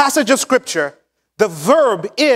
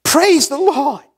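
a hint of a boastful pastor, higher at the end; mock proud boast; 5 semitones higher than usual. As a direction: lightly ham up a self-satisfied church report. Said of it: "and say".

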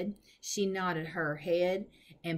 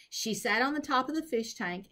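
plain reading voice

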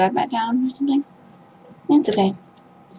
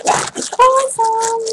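excited praise; 12 semitones higher than usual. Real, not acted. Admiring squishy.

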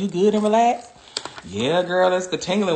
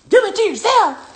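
high, thin mocking squeak; mock squeaky voice; 14 semitones higher, 6 dB louder than usual. Mocking a viewer's comment in a squeaky voice. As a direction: squeak it out like a taunting chatter.

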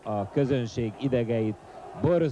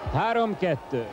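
excited score call; 7 semitones higher than usual. Real, not acted. Score after goal.

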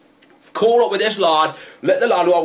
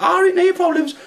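very high mock girl voice; imitating Scruffy Lisa; 13 semitones higher than usual. Passenger answering the driver in a very high mock voice. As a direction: squeak it back at him. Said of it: "she's like".

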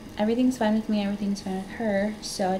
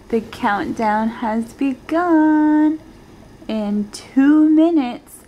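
louder and slower, building the big moment; excited countdown; 4 semitones higher, 8 dB louder, much slower than usual. Real, not acted. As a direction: announce it like a grand countdown. Counting down baby's first day.

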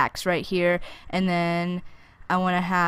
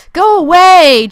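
shouting go away; 10 semitones higher, 12 dB louder, slower than usual. Real, not acted. Yelling at zombie.

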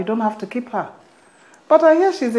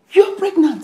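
higher, louder burst of excitement; excited realization; 8 semitones higher, 8 dB louder than usual. Acted. Mother guessing her daughter is pregnant, excited. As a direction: exclaim it as joyous news. Mother jumps to joyful conclusion.

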